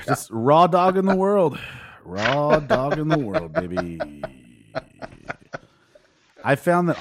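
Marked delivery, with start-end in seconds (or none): 0.1-1.6 loud, high punchline delivery; excited punchline. Acted. Punchline repeated for effect. 2.1-4.0 laughing through it, slowed down; slow laughing repeat. Acted. Punchline repeated for effect.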